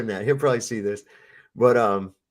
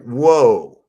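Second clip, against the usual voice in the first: louder, astonished retold whoa; 10 dB louder than usual. Hearing the kids were rescued, he replays his amazed whoa. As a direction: let out a big amazed whoa.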